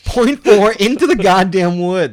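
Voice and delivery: slow, loud disbelief at the cops; exasperated disbelief. Real, not acted. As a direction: hammer home how absurd it was. Incredulous retelling of cops walking far.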